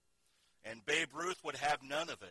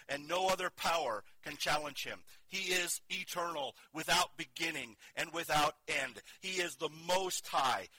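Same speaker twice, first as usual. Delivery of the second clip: building preaching; 4 semitones higher, 3 dB louder than usual. Acted. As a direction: preach it with rising conviction. Preaching builds God's attributes.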